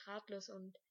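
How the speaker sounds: plain reading voice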